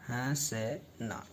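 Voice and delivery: हंसना very slow, syllable by syllable, soft and low; हंसना drawn out quietly; sounded out slowly. Shown as a demonstration. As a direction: break हंसना into हं, स, ना. Teacher sounds out the word.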